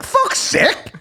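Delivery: high, breathless mock groan of despair; a pretend appalled gasp; mock exasperation. Imagining the reaction to big women arriving, high voiceless mock despair. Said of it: "you're like".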